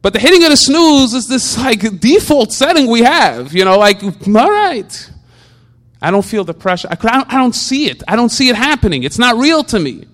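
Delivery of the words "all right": a lazy, much higher drawn-out all right; dismissive all right. Retold or imitated. Shrugging off the alarm clock, a lazy high all right. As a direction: shrug it off like the pressure isn't real. Snooze default, brushing it off.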